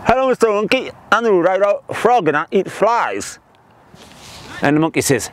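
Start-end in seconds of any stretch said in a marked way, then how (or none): 0.1-3.4 joke-telling voice of a wide-mouthed frog; exaggerated wide-mouthed frog greeting. Frog proudly introduces himself to a monkey, wide-mouthed frog voice.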